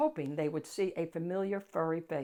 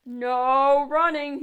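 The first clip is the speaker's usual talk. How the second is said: loud, drawn-out call, much louder and higher; calling after; 8 semitones higher, 14 dB louder, much slower than usual. A narrator's reading. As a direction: call it out slowly down the hall. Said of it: "he called".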